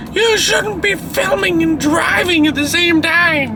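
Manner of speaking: high, slow scolding mom voice; mom impression voice. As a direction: nag like a worried mom. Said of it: "That's my mom impression voice".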